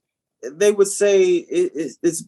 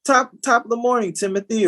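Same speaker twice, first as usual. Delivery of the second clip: higher, chirpy morning hello; mock polite greeting; 7 semitones higher than usual. Coworker turning to greet Timothy at work, mock polite. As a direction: lift it into a proper office greeting. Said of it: "to Timothy and they say".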